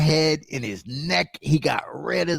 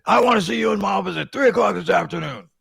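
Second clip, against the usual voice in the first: loud, higher, fast angry boss shout; boss yelling; 7 semitones higher, 6 dB louder, faster than usual. Retold or imitated. Boss ordering him to the office, fast angry shout. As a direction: bark the order like a furious boss. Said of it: "He said".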